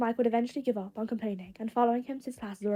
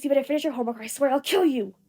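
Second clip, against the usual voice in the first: louder, hot-tempered bark; furious roommate yelling; angry threat; 3 semitones higher, 6 dB louder than usual. Acted. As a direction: threaten him so he bolts. Bakugo threatens Denki from the kitchen.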